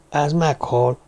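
plain reading voice

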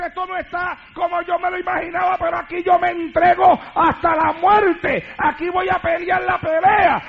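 proclama de lucha, aguda, que sube a grito; determinación desafiante. Acted. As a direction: declara con fuerza que no te rindes. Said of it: "Pero si te llamaron, tú dices". (arenga actuada, firme y creciente)

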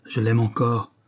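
building from plain to a furious shout; raging. A narrator's reading. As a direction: let the rage swell into a cry. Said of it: "avec l'accent de la rage la plus vive".

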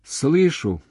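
high, mildly sobbing answer; tearful. A narrator's reading. Woman answering him on the phone, tearful. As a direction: answer with a touch of tears. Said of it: "рыдая навзрыд, сказала она".

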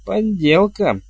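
put-on high, scornful old nanny; disdainful muttered verdict. A narrator's reading. Nanny inspects the stage cauldron, disdainful. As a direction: sneer at a shoddy fake. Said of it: "процедила нянюшка Як".

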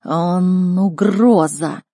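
very slow, drawn-out talking beast; weighty, stretched creature voice; snow leopard. Acted. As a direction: warn like a great predator, word by word. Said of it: "произнёс Барс".

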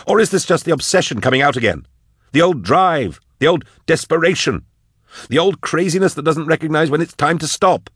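high-pitched angry tirade; yelling. A narrator's reading. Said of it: "yelled Drusha".

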